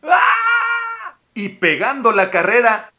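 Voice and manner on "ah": terrified scream. A narrator's reading. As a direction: scream and run away. Gruffalo runs away.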